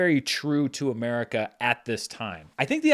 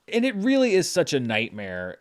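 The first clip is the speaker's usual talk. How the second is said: ordinary delivery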